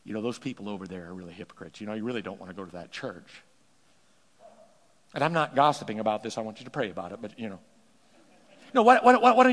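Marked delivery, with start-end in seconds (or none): 0.0-3.4 hushed, falsely spiritual advice; soft mock-pious tone, lower; pious gossip voice. Preacher mimicking a churchgoer's pious gossip. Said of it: "We say to people". 8.7-9.5 louder outburst. Acted. Preacher breaks in.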